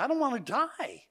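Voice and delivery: put-on high, small child's fearful voice; boy at bedtime, high and frightened; frightened little boy. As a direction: play the scared child refusing. Boy's fearful answer retold.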